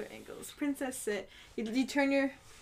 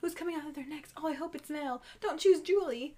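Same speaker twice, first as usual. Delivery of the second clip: higher-pitched mock gossip; hushed, raised-pitch chatter building to a plea; 4 semitones higher, 4 dB quieter than usual. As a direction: imitate contestants eagerly speculating among themselves. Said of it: "kinda gossiping".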